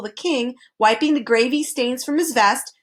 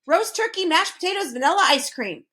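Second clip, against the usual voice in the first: bouncy recital; 8 semitones higher than usual. A narrator's reading. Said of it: "recited the humbug, bouncing up and down".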